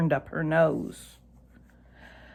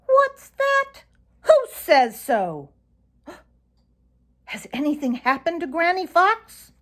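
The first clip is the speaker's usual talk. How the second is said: high, gasping alarm; sudden fear; 12 semitones higher, 4 dB louder than usual. A narrator's reading. Reddy fears for Granny Fox, gasping and high. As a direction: blurt it out in a panic, much higher. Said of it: "in a sudden great fear".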